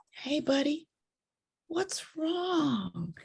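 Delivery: high, soft voice for a little one; soothing concern for a small child; gentle to child. Retold or imitated. Addressing the crying child.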